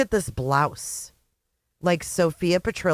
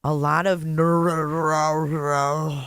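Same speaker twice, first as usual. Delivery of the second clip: drawn-out slurred imitation; mocking drunken slur; 3 semitones lower, much slower than usual. Reactor mimics the possibly drunk man in the clip, mocking slur. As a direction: slur it into a long garble, mocking him.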